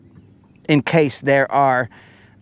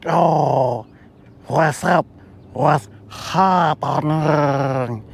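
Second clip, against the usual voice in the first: alien voice; much slower than usual. Pretend. Alien greeting him.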